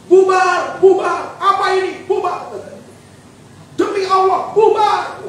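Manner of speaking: high-pitched acted yell; strained, high storyteller's shout; imitated mob yelling. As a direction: mimic a mob ordering everyone out. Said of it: "wah, mereka teriak".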